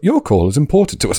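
high recorded hold message voice; sing-song it like a call centre recording; phone menu voice. Retold or imitated. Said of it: "pre-recorded messages".

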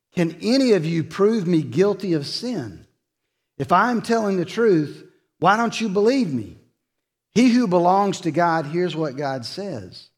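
Jesus confronting his accusers, preached high and loud; preaching at full force. Acted.

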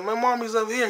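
a hint of a scared little girl. Little girl answering Bruce.

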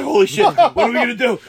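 high, loud retold panic; acted-out alarm; freaking out. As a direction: ask in comic desperation. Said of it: "we're freaking out".

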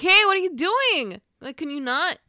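sharp shout over the fence; yelling. Retold or imitated. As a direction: call out loud to stop her.

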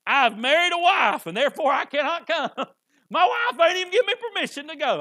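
high, loud, laughing excuse. Retold or imitated. Preacher mocks a guest kept home by his wife, laughing. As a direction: laugh through the henpecked excuse.

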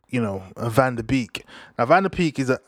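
ordinary talk

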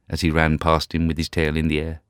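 ordinary delivery, nothing marked

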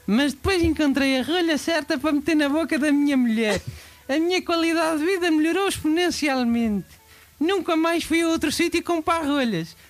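earnest commercial testimonial voice. Acted. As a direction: sell it like a TV ad. Fake testimonial ad read.

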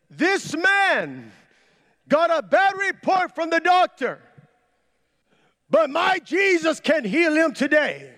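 high, loud televangelist proclamation; loud shout. Retold or imitated. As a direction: shout it to the whole room, drawing out the words. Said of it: "I shouted out loud".

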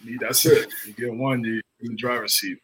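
usual speaking voice

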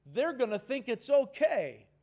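a mock excuse pushed out loud; self-justifying whine. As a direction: talk yourself into it, loud and insistent. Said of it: "and I say".

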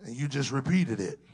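ordinary talk, nothing marked

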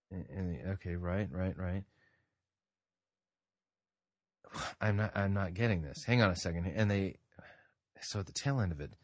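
quiet mumbling while reading a text; distracted low-volume muttering over a book; muttering to himself. Real, not acted. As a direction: mumble under your breath, puzzling it out. Muttering while checking Greek text.

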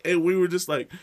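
ordinary talk, nothing marked

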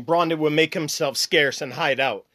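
ordinary talk, nothing marked

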